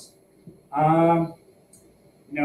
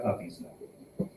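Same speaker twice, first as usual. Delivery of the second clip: whispered afterthought; 9 semitones lower, 14 dB quieter, slower than usual. Real, not acted. Muttered afterthought.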